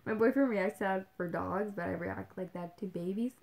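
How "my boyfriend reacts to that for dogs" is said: ordinary delivery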